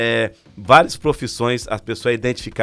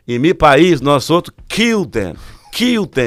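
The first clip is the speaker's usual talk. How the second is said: higher and louder, rising to "kill them"; menacing Mexican cellmate; 4 semitones higher, 5 dB louder, slower than usual. Retold or imitated. Cellmate boasting about killing Christians back home, loud mock-menacing voice. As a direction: push the threat up in pitch, half-mocking.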